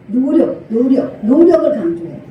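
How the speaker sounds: ordinary delivery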